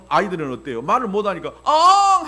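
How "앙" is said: put-on loud high baby wail; baby cry. Pastor imitating a one-year-old's hungry cry. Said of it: "하고 울면".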